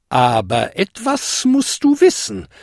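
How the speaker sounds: put-on cautioning old lady, rising in pitch; warning. A narrator's reading. Godmother cautioning the kobold, warning. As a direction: build up to a caution. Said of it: "warnte sie".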